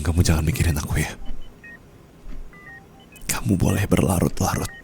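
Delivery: a dying goodbye, barely holding on; weak low farewell. Acted. Dying spouse bidding partner farewell, low and weak.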